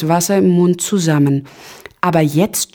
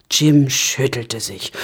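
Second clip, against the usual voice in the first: usual speaking voice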